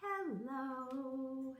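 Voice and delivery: a sweet, sung Hello; nice hello. Shown as a demonstration. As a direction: sing Hello kindly to the kids. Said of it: "as nicely as we can".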